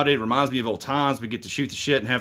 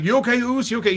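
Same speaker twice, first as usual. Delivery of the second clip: mock concerned buddy; 10 semitones higher, faster than usual. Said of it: "he's like, literally".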